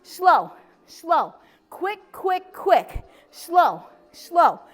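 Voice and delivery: long falling "slow", short even "quick"; rhythmic workout count. Shown as a demonstration. Instructor counting leg lifts for the class, rhythmic cadence. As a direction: call the beat, stretching SLOW and tapping out QUICK. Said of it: "Here we go".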